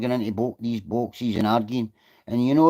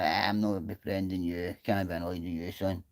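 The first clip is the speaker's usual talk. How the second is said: dismissive brush-off; 8 semitones lower, 12 dB quieter than usual. Retold or imitated. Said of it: "I'm like".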